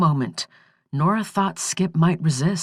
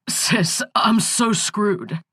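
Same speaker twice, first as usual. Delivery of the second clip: slow, quavering near tears; barely holding back the tears; 4 semitones higher, much slower than usual. Acted. Brother telling his sister he's doomed, slow and near tears. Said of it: "His voice was quavering, on the edge of tears".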